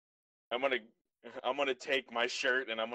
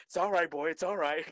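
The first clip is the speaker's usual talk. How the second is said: higher-pitched mock Australian accent; Steve Irwin; 5 semitones higher than usual. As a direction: soothe the beast in a put-on Aussie accent. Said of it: "got an Australian accent like I'm Steve Irwin".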